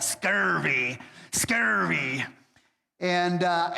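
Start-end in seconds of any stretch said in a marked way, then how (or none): 0.0-2.2 growled pirate scurvy. Shown as a demonstration. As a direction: say it like an old sea pirate. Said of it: "you gotta say it like a pirate".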